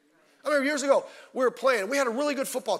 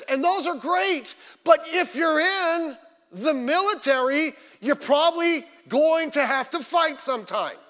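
high, loud, exasperated sermon shout; full-force preaching; 8 semitones higher, 6 dB louder than usual. Acted. Preacher scolding soldiers who didn't expect to fight, full-force exasperated shout. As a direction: preach it hard, pitched up and loud.

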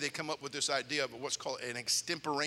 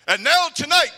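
much higher, louder announcer voice; news announcer; 13 semitones higher, 16 dB louder than usual. Retold or imitated. TV news anchor introduces story to viewers, booming. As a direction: boom it like a TV anchor. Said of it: "news announcer goes".

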